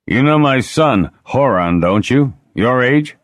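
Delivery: a hint of a hearty lord; loud, high bluff lord; bluff old lord. Gruff lord Grogi voiced.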